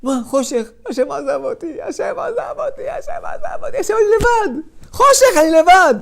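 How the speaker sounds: despairing wail. Acted. The person outside the door lamenting God left him, wailing high. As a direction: whine it like someone left alone. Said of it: "מה אתה אומר בחוץ לדלת".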